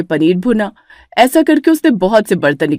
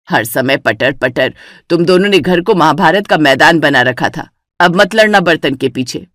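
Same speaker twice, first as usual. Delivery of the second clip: put-on lower, stern old mother-in-law; a lower, annoyed elder's voice; 4 semitones lower than usual. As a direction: scold the bickering daughters-in-law. Mother-in-law scolds both.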